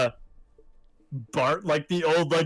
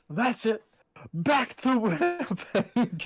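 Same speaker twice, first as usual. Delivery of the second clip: higher, slow put-on voice; meme quote; 7 semitones higher, much slower than usual. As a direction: drag out the meme line. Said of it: "I still just love".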